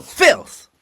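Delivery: high, loud one-word curse; furious shout. Acted. Shevchenko curses the enemy with a furious shout. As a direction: spit it out in rage.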